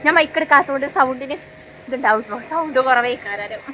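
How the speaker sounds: normal talking voice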